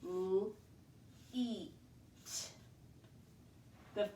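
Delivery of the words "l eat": l-eat said softly, l then long ee; segmenting sounds. Shown as a demonstration. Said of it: "I'll dash that out".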